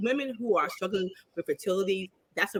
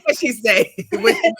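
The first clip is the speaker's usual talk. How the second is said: laughing; 7 dB louder than usual. Real, not acted. Laughing asking gossip.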